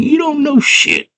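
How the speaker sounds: squeaky goblin taunt. Retold or imitated. Hoggle mocks her.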